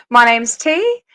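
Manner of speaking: ordinary delivery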